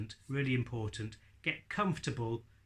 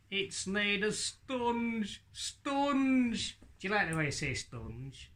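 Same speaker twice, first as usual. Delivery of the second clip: slow and much higher, "sponge" said as "stonge"; silly puppet voice; 6 semitones higher, slower than usual. Acted. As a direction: stretch out "sponge" like a daft puppet. Puppet explains its brain.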